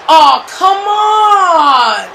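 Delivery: slow, loud protest; outraged shout. Real, not acted. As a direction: drag out the outrage. Mock outrage at Christmas specials.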